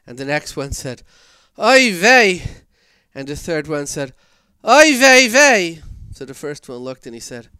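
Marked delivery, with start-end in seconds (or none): 1.6-2.4 put-on Yiddish accent. 4.7-5.8 put-on Yiddish accent.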